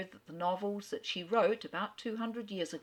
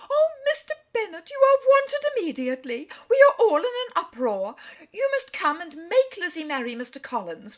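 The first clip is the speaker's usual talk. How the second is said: much higher and louder, flustered and frantic; Mrs Bennet calling out; 10 semitones higher, 10 dB louder than usual. Acted. To her husband in the library, a frantic much higher wail. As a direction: burst in crying for help, all in a fluster. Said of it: "called out as she entered the library".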